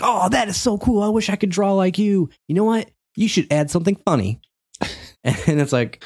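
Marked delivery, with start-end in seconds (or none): 0.0-4.4 put-on high-pitched, gushing kid voice; excited Chris. Chris admiring the drawing, excited kid voice. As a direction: imitate an admiring little boy, pitched way up. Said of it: "Chris came over to me and was like".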